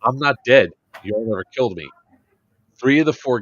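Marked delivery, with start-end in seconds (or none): 0.0-1.9 louder, taunting shout; retold taunt. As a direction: call it out as if gloating. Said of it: "And I was like".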